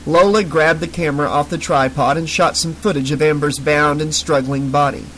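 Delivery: ordinary delivery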